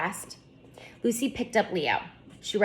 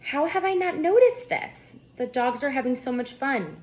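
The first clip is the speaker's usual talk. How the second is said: put-on girl bursting with excitement, much higher; excited; 6 semitones higher than usual. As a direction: squeal with delight at the dogs. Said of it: "Lucy said excitedly".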